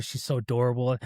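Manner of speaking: ordinary talk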